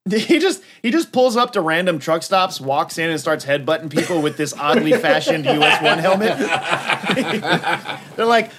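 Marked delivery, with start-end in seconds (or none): none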